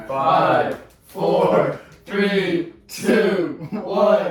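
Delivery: calling out the countdown loudly; big dramatic count down. Real, not acted. Loud countdown for reveal.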